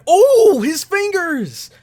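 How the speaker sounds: high, loud "oh" drawn into dismay; shocked cry. Real, not acted. Viewer reacts to his fingers on screen, shocked cry. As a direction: recoil aloud, voice shooting way up.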